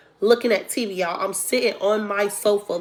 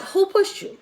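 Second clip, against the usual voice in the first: high-pitched, indignant questioning; retold demand, pitched way up; indignant attitude; 10 semitones higher than usual. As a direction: demand it with mama-bear attitude. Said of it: "I got an attitude".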